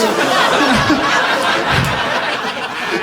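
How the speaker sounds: a high, airless burst of laughter; high breathy laugh. Real, not acted. Comic cracking up at own story, high laugh.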